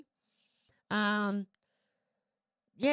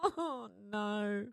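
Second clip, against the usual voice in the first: usual speaking voice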